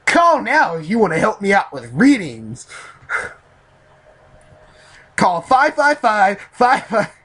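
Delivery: high, loud mock advert pitch; mock hotline ad. Reader jokingly begs listeners for help with readings, mock ad pitch. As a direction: belt it out like a phone-in commercial.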